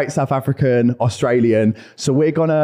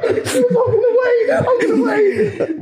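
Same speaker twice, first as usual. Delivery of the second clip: high, slow words through helpless laughter; real laughing fit, words stretched high; much slower than usual. Real, not acted. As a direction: try to talk but keep cracking up. Laughing too hard to continue.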